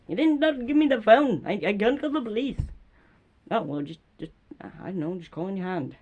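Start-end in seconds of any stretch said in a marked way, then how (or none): 0.1-2.6 loud, higher exasperated protest; pretend angry complaint. Neighbour can't call police, loud then calming. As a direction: fume loudly, then ease off.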